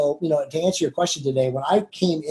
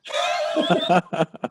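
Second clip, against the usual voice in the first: high burst of real laughter; tickled, spontaneous laughing; amused laugh; 4 semitones higher than usual. Real, not acted. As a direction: laugh at your own story. Laughs at own funny story.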